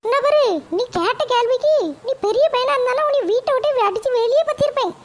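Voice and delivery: high-pitched mother fuming about her son; shrill-pitched scolding mum, fed up; annoyed mother. Acted. Mother complains to grandma about her son, high and irritated.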